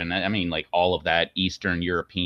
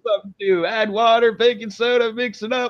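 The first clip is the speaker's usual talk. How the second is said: high, fast comedian voice; squeaky hyped-up comedian bit; Cat Williams impression; 5 dB louder than usual. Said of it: "I love Cat Williams".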